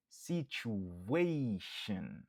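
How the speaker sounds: very slow Situation with the ey syllable leaned on; slow model of Situation, accent on ey; stress on ey. Shown as a demonstration. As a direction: stretch out Situation and hit the ey. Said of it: "n ap aksantye sou".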